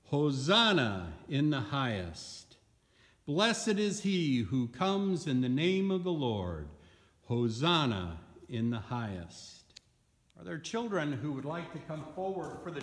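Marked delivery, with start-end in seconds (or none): 0.0-9.8 loud, ringing scripture proclamation. Acted. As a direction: proclaim it to the whole congregation, loudly.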